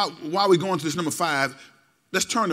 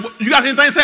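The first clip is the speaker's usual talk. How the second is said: a hint of a stern mama, higher and louder; challenging mama; 6 semitones higher, 9 dB louder, faster than usual. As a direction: dare the child to talk back. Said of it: "Your mama said".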